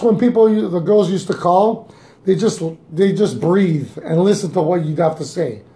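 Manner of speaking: ordinary delivery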